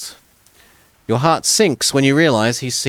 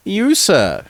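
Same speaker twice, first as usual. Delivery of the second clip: much higher, refined lady's voice; refined noblewoman; 9 semitones higher, 3 dB louder than usual. Acted. Noblewoman calls to the stranger by the road, refined and high. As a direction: call out like a gracious noble lady. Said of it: "with a refined Palmyrean accent".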